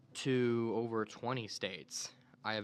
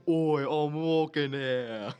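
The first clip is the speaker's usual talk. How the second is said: put-on slow, louder New York accent; New York tough guy; 3 semitones higher, 8 dB louder, much slower than usual. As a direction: stretch it out like an offended street tough. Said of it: "this guy just saying".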